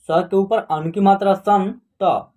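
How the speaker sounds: ordinary delivery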